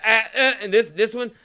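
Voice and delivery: loud, high, flustered stammer; mock flustered stammer. A cop caught off guard, loud flustered sputter. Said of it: "He's like".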